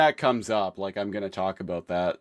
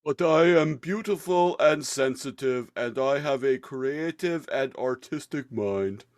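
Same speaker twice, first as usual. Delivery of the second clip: sensitive artist voice; 4 semitones higher than usual. Retold or imitated.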